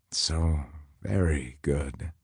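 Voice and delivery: soft, slow, lingering very good; savoring hush. A narrator's reading. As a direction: let very good melt away slowly. Narrator repeats very good.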